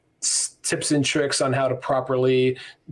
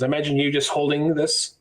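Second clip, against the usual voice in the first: ordinary delivery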